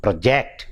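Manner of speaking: pro-JECT, weight on JECT; second-syllable stress. Shown as a demonstration. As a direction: verb "project" with a light first syllable. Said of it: "the stress shifts from the first syllable to the second".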